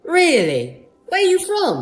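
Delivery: imitated British stranger's accent. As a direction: quiz them in a mock British accent. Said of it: "didn't expect them to have an accent".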